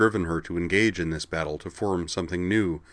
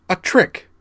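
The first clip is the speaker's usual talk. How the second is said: much higher, louder outcry; crying out; 12 semitones higher, 7 dB louder than usual. A narrator's reading. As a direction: cry it out in triumph. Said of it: "she cried".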